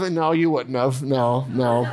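normal talking voice

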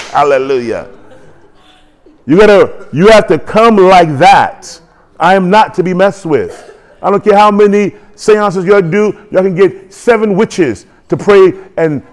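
ordinary talk

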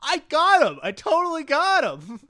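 high, loud smug gloat; gloating triumph. Retold or imitated. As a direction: crow like you've caught him out. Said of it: "It's like".

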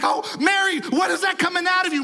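high, rushed, hammed-up panic; mock distressed Joseph. Joseph reacting to Mary giving birth, mock distress. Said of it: "Joseph is crying. He's like".

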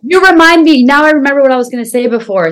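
sudden eager "oh yes!" rush; excited recall. Real, not acted. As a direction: jump in as the thought suddenly comes back. Sudden recollection mid-conversation.

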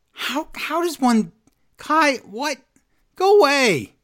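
high, incredulous exasperation; exasperated disbelief. Real, not acted. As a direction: throw up your hands in disbelief. Reacting to botched finisher.

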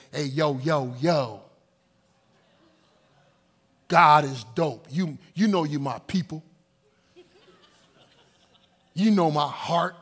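low, over-familiar homeboy greeting; mock casual street prayer. Preacher imitates people today praying too casually, mock street slang. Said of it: "Some people today".

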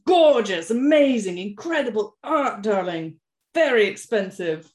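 loud, gushing mock art snob; gushing posh luvvie. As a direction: rattle off praise like a rich socialite. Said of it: "and be like".

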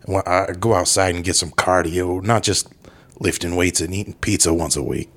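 put-on British action-star accent; Jason Statham. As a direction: talk like a laid-back British tough guy. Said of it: "how was his accent".

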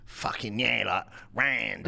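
lightly put-on Cockney; a hint of a Cockney lad; Cockney accent. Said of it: "I wanted to talk more like".

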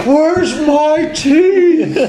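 high, slow, loud crackly geezer; old man giant voice. Acted. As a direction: drag it out like a confused giant grandpa.

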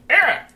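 very high, loud squawk; piercing acted cry of pain; dwarf's pained cry. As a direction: yelp in pain, high and sharp. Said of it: "you hear the dwarf call out in pain".

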